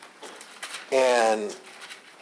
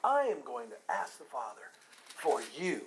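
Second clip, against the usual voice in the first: leading "I" stressed, the rest falling softer; emphatic promise; 2 semitones lower, 6 dB quieter than usual. Shown as a demonstration. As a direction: stress "I", then settle down onto "for you". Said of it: "when he's emphatic like that".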